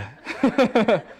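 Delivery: a short burst of laughter; real laugh. Real, not acted. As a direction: laugh at the joke. Laughing at own bliss bit.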